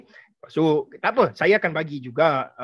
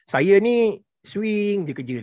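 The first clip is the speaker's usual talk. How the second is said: slow, drawn-out mimic of a client; slow mock client voice; client quote; 5 semitones higher, much slower than usual. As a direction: drag it out like a lazy client complaining. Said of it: "klien saya dok cakap".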